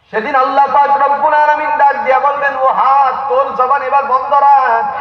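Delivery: high-pitched, forceful sermon call; full-force preaching. Acted. Preacher voicing Allah summoning hand and foot to testify, full-force preaching. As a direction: proclaim it high, like a divine summons. Preaches God's command on judgment day.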